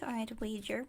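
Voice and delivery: normal talking voice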